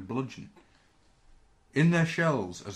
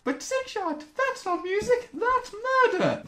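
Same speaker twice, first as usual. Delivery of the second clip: much higher scandalised voice; high mock scold; 5 dB louder than usual. Host voicing a shocked critic of Sixshot, very high pitched. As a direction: squeal it like a prim critic.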